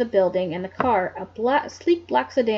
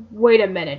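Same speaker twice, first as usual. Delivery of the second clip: commanding; 3 semitones lower, 5 dB louder than usual. Acted. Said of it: "he commanded".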